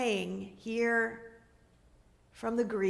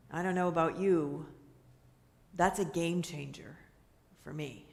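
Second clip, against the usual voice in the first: usual speaking voice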